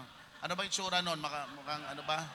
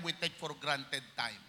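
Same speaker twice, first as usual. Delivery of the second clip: normal talking voice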